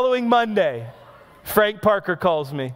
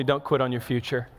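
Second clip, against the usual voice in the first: softer and lower, the quiet voice of God; gentle divine voice; 7 semitones lower, 5 dB quieter than usual. Retold or imitated. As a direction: say it softly as a tender command. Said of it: "but God told me".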